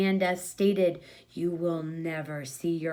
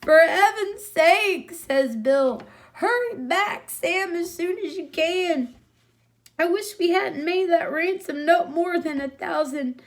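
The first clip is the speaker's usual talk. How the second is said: much higher, louder flustered man; a fed-up man yelping under a kicking boy; panicked Bill; 7 semitones higher, 5 dB louder than usual. Acted. Bill panics, being ridden.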